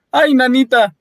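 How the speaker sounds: high frightened squeal; Diego, frightened. A narrator's reading. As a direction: yelp it in sudden panic. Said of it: "dijo Diego".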